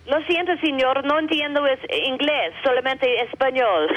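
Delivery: high, innocent only-speaks-Spanish voice; playing a Spanish speaker. Daughter fooling the angry farmer, innocent Spanish speaker.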